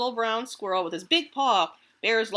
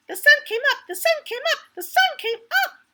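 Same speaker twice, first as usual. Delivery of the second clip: much higher, louder squirrel chant; chirping gleefully; 7 dB louder, faster than usual. Acted. Squirrel gloats at the sunrise, high and loud. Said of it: "he chirped".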